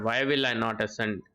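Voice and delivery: ordinary talk, nothing marked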